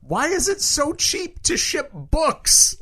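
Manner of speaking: slow, high disbelieving question; incredulous. Real, not acted. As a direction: stretch it out in disbelief. Incredulous rant about cheap shipping.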